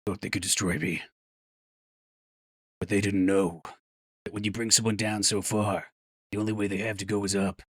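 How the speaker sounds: low, quiet inspirational voice-over; solemn motivational speech, kept low and soft; motivational video narrator. Retold or imitated. Streamer quoting a favourite AI motivational video, low solemn narrator voice. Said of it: "that A I motivational video".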